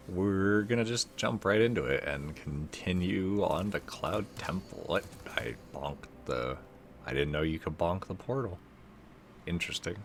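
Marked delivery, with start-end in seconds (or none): none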